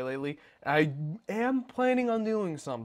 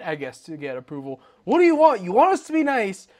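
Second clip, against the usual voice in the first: high-pitched, indignant venting that builds louder; exasperated rant; 7 semitones higher, 7 dB louder than usual. Real, not acted. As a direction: protest in disbelief, getting louder and more worked up.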